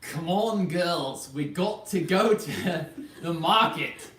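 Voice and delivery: put-on slow, emphatic Scottish accent; rally the girls in a drawn-out Scottish accent. Host urges the girls to market, slow Scottish accent.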